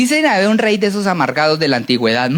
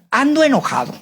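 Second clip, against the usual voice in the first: higher-pitched mock annoyance; angry king; 6 semitones higher than usual. King calling in his advisers, mock angry. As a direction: complain as a cross king. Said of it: "dice el rey".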